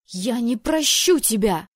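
loud, slow tearful cry; sobbing sister storming off; through tears. Acted. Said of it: "Сквозь слёзы выдавила она".

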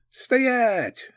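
high barked order; barked command. Acted. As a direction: bark it like a cop. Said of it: "рявкнул гоблин".

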